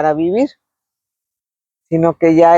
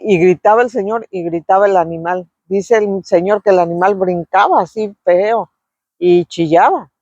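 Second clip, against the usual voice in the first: ordinary talk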